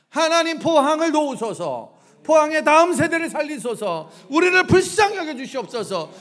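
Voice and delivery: loud, high, impassioned prayer; crying out. Acted. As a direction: cry out to God for mercy at full voice. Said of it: "소리, 소리 질러". (소리 높여 부르짖는 기도)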